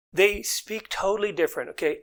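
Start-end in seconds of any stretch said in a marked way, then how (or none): none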